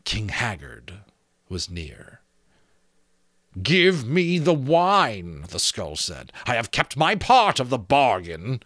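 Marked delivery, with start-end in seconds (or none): 3.6-5.5 high, slow, drawn-out demand; a skull collecting its due. Acted. 6.5-8.6 a skull collecting its due; demanding skull. Acted.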